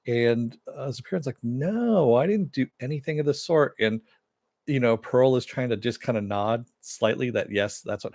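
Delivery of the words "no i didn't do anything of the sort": higher, mock-innocent denial; Superion denying. Host voicing Superion denying a threat, raised pitch. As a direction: lift it up, protest lightly.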